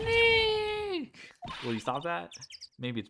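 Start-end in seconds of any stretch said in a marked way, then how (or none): 0.0-1.1 very high, loud cry; piercing shout to trigger a camera; louder test call. Acted. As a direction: belt out the name way up high. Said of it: "Maybe I'm not saying it loud enough".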